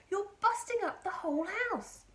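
put-on high, loud boy's complaint; called-back protest. A narrator's reading. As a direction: protest loudly up to Grandma. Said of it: "George called back".

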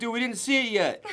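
quick, high imitation of protesting friends; retold freak-out. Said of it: "Everyone was freaking out".

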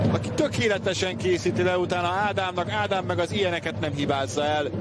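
usual speaking voice

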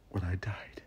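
whispered, tearful confession. Acted. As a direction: breathe it out as a secret. Confessing her death to friends.

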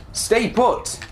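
high, sing-song call; playful high-pitched command; mock coaxing call. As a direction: call out high to a runaway animal. Calling to a fleeing emu.

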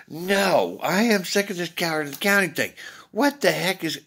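high, loud, fed-up protest; retold angry outburst. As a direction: snap back in a heated quarrel. Arguing with the Dynamic Duel.

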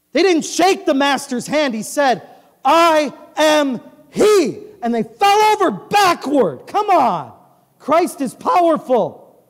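loud, slow pulpit proclamation; preaching at full force. Acted. Preaching returns after jokes.

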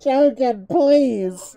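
loud, high exasperated yell; high-pitched begging shout; shouted plea. Real, not acted. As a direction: yell it pleadingly at a teammate. Calling out to teammate in game.

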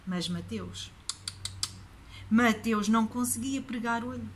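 ordinary delivery

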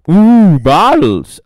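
loud, slow, stretched excitement; thrilled to see bottles; delighted ooh. Real, not acted. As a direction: savour the find.

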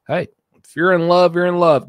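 loud and high, declaring it to the room; loud proclamation. Real, not acted. Host declaring love to his co-hosts, loud and high. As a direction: belt it out like a slogan. Shouting mock wisdom about love.

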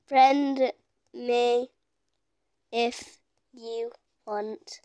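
one word at a time, as if typing; typing aloud. Real, not acted. Sounding out words while typing.

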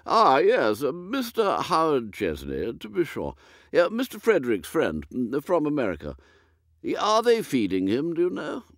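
put-on vague old earl. Lord puzzling over dinner with his butler, high and dithery.

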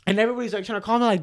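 ordinary delivery

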